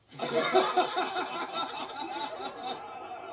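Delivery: high loud jeering laughter; mocking laugh. Thugs laughing at the dummy, jeering. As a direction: cackle at the dummy.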